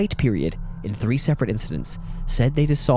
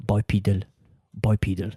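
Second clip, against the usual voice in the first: deadpan, slightly lower tutorial voice; isolated word, accented instructional delivery; pronunciation-site voice; 3 semitones lower than usual. Retold or imitated. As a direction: repeat the word like an online pronunciation guide. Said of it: "sites where they were like".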